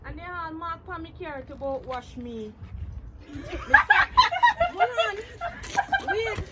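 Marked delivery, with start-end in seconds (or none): 2.6-4.7 high, loud laughing fit; real helpless laughter; loud burst of laughter. Real, not acted. 4.7-6.4 laughing. Real, not acted. Still laughing.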